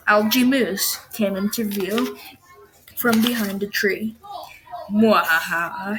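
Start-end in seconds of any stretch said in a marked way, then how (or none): 4.9-6.0 slow mock evil laugh; sneering panto villain. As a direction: ham up a villain's cackle. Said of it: "he said".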